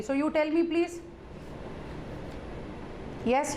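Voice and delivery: normal talking voice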